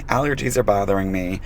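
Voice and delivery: normal talking voice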